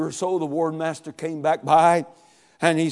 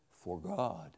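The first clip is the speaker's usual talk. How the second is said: weak repeat; 11 semitones lower, 17 dB quieter than usual. A narrator's reading. Dying boy repeats the verse.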